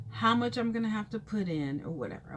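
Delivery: ordinary delivery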